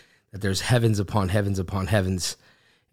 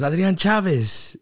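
calling out again; 9 semitones higher, 4 dB louder than usual. Retold or imitated. Stranger repeats the name at the sink, mock insistent call. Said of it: "He goes".

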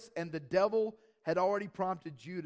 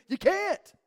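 high ringing preacher's cry, louder; emphatic shout; 12 semitones higher, 10 dB louder than usual. Real, not acted. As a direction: call it out to the whole church. Preacher rebuts excuses.

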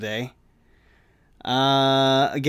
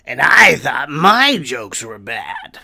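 slow, louder mock villain taunt; lightly voiced sneering villain; Joker line; 2 semitones higher, 8 dB louder, slower than usual. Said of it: "some impersonation".

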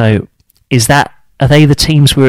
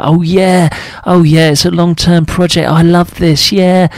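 higher, sing-song mockery of a gushing fan; mocking fan voice; 7 semitones higher than usual. Vlogger mocks Chelsea fans who love the project, in a high sarcastic voice. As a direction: lightly send up the happy-clappy supporter.

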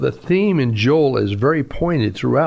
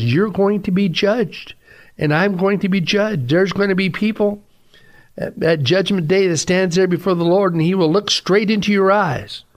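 higher-pitched, urgent sermon delivery; forceful preaching; 7 semitones higher than usual. Real, not acted. Preacher warning the congregation of Judgment Day, forceful preaching. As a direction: press the warning home from the pulpit.

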